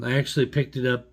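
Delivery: ordinary delivery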